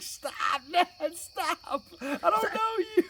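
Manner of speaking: high-pitched mock pleading kid; mortified daughter. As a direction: imitate a kid begging dad to stop. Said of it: "She's like".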